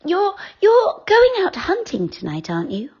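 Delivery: high, light fairy voice; decide it at last, gently; fairy Silky. A narrator's reading.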